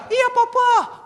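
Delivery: put-on very high, loud little boy; small child's voice. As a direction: squeal it as a dangling toddler. Son answers.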